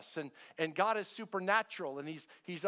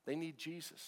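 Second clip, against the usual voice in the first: hushed; 7 dB quieter than usual. Acted. Pastor repeating the point to the church, hushed. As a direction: whisper back They need Jesus.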